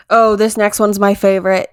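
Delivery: normal talking voice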